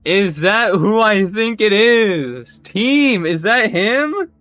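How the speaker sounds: slow, high, very loud mock astonishment; theatrical amazement. Asking the team is that who I think, theatrical amazed shout. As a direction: call out in drawn-out, hammed-up disbelief. Hammed-up reaction to a sighting.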